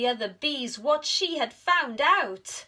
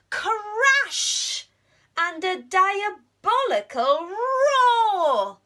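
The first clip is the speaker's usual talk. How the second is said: high, loud, slow dramatic telling; dramatic crash and roar; 11 semitones higher, 9 dB louder, much slower than usual. A narrator's reading. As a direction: make the night attack boom. Narrator sounding the bear's attack.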